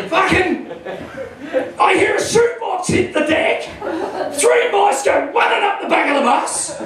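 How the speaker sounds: ordinary talk, nothing marked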